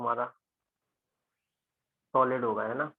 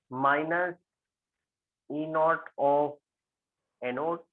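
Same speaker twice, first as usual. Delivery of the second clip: stretched, slightly louder dictation; teacher stretching the formula out on the board; slow dictation; 3 semitones higher, 4 dB louder, much slower than usual. Real, not acted. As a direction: draw each word out as you write it. Dictating formula while writing.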